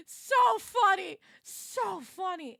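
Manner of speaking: high, slow, drawn-out gasp of amusement; helplessly amused. Real, not acted. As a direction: draw it out high, worn out from laughing. Recovering from helpless laughter.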